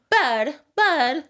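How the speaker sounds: imitating a child's British "bud"; British accent. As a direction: copy a little kid saying "bud" in British. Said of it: "Malibu has a British accent".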